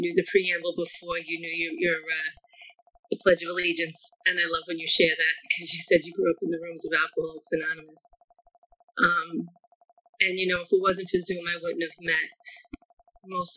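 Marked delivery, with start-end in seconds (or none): none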